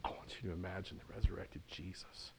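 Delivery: low, very soft, near whisper; hushed invitation. Real, not acted. Preacher asking listeners to imagine the resurrected Jesus, hushed. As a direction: draw them in with a reverent hush.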